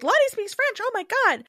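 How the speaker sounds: very high-pitched imitated astonishment; mock amazement. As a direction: squeal it like a gossiping onlooker. Acts out the group's astonishment.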